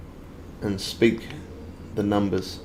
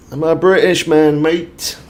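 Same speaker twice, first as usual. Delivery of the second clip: playful British; 7 semitones higher, 8 dB louder than usual. Acted. Playing up British accent.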